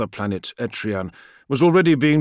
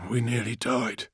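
breathy, softer disbelief; 6 dB quieter than usual. A narrator's reading. Friedrich to Call after nearly dying, breathy. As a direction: say it on a shaken breath. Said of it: "he said breathily".

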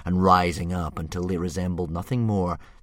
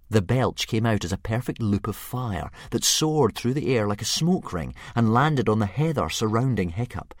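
ordinary delivery, nothing marked

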